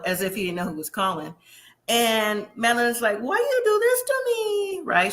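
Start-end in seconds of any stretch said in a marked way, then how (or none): 3.2-4.8 high, whiny imitation of an upset young woman; mock whiny girlfriend. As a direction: whine it like a hurt girlfriend, hammed up. She's upset.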